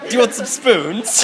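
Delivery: high, drawn-out sales offer; hammed-up salesman's come-on; spoon salesman. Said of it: "you see the spoon salesman".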